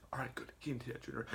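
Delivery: very soft, hushed whisper. Retold or imitated. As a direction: whisper it so the teacher won't hear. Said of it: "Jeffy whispers".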